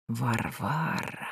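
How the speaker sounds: slow, low eerie calling; creepy whisper. Acted. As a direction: lure her with a drawn-out name. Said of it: "Жуткий шёпот".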